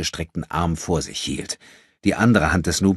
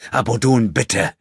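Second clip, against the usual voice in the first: raised, pressing appeal to a friend; urgent plea; 5 semitones higher, 5 dB louder than usual. A narrator's reading. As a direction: beg him to stop. André pleads with his friend.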